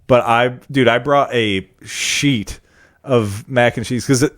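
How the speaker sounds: usual speaking voice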